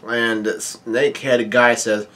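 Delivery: usual speaking voice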